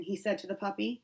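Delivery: plain reading voice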